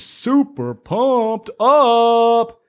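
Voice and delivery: slow, high hype call; pumped up. Acted. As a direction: stretch it out, hyped. Echoes hype catchphrase.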